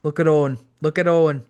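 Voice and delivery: cheering cry, loud and high; gleeful cry. Retold or imitated. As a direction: holler it across the ring at a mate. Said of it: "heard Bulldog's familiar cry".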